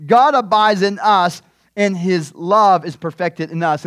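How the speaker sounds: slow, loud, deliberate Bible reading; scripture reading. Acted. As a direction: read the verses out slowly and firmly to the church. Reads scripture aloud slowly.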